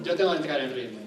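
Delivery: ordinary talk, nothing marked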